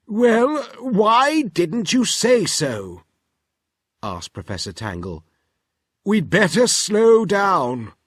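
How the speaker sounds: put-on busy inventor brushing the children off; Professor Tangle voice. Professor answers calmly.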